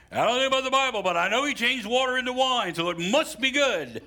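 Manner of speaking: high, loud mocking voice of a casual wine drinker. Preacher mocking a wine drinker, high and loud. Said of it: "You've had that conversation with somebody".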